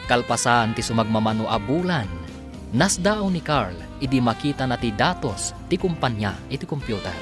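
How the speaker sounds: normal talking voice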